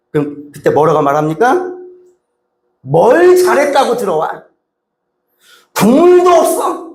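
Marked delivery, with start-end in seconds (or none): 2.8-6.9 much higher, slow furious scolding; scolding parent. Retold or imitated. Parent scolding a son who came back, angry. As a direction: rage at the runaway son. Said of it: "이러잖아요".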